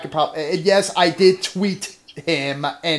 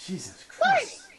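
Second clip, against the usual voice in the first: exasperated; 3 dB quieter, slower than usual. Real, not acted. Reacting to long script.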